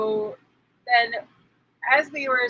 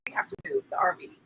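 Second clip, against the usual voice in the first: whisper; 7 semitones higher, 5 dB quieter, much faster than usual. Real, not acted. Aside about moving RV.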